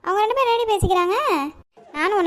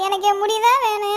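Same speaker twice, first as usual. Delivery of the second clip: ordinary talk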